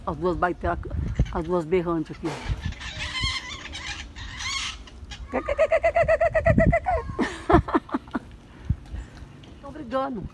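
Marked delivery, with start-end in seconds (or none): 2.3-8.2 high kekeke cackle; cackling laugh. Real, not acted. Laughing at the two noisy parakeets, a high cackle.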